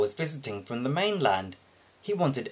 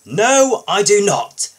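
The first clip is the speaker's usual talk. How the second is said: loud, haughty sneer, slowing at the end; a snooty engine scorning the crane; 8 dB louder than usual. Acted. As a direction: look down your nose and scoff. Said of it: "Spencer sneered".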